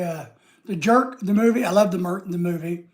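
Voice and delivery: ordinary talk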